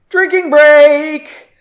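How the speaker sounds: long, loud, high call; shouted announcement. Real, not acted. Reader calls a break from the chapter, shouting gleefully. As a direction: belt it out like a bar toast. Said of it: "announces own break".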